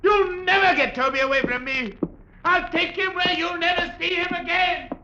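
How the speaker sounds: very high, hysterical screaming; frantic shouting. Acted. Ventriloquist screams he'll never give Toby up, very high. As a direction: lose control completely.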